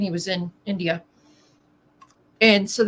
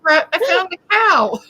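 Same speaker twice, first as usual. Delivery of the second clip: put-on excited kid; 8 semitones higher, 8 dB louder than usual. Acting out the daughter bringing animals.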